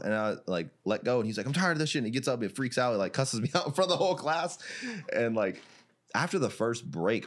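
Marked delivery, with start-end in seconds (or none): 1.5-2.0 high, fed-up outburst; angry. Retold or imitated. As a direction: storm off in a rage. Opponent storming off.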